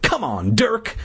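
high pleading call. A narrator's reading. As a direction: beg him, pitch rising. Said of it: "I pleaded".

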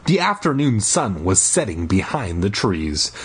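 ordinary delivery, nothing marked

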